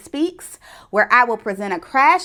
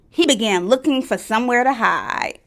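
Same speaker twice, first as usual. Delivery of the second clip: ordinary delivery, nothing marked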